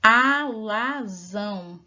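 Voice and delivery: Alazão drawn out slowly, syllable by syllable, loud; slow syllable stress. Shown as a demonstration. Teacher sounding out Alazão for children, slow and loud. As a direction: stretch Alazão out so the class hears the ão. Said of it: "o ão presente aqui na última sílaba".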